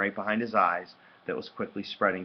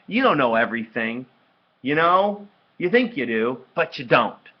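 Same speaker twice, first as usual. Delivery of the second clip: loud, high, heated comeback; angry argument; 5 semitones higher, 10 dB louder than usual. A narrator's reading. Brother attacking know-it-all sister, angry. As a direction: hammer each phrase at her.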